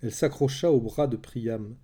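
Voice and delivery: ordinary delivery, nothing marked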